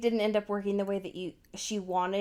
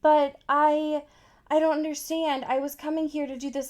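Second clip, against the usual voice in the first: higher-pitched mock bewilderment; protesting; 6 semitones higher, faster than usual. Caught intruder explaining himself to roommate, higher protesting voice. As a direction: plead your case in a raised pitch. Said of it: "he's trying to be like".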